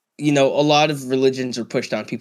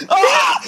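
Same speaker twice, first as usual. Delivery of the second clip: high startled shriek and laughter; real fright turning to giggles; scream into giggles; 6 dB louder than usual. Real, not acted. Scared by mom in face mask.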